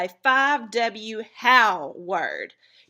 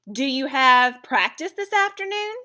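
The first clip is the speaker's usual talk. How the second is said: higher, louder question, "afternoon" held up; questioning inflection; 5 semitones higher, 7 dB louder than usual. Shown as a demonstration. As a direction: ask brightly, pitch raised through "afternoon". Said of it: "notice the inflection of the question".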